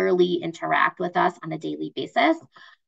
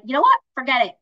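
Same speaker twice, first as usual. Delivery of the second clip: higher, louder burst of impatience; fed-up decision; 6 semitones higher, 5 dB louder, much faster than usual. Acted. Stranded driver giving up on waiting, fed-up decision. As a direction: throw up the plan, pitch jumping on the question. Acting someone fed up, leaving.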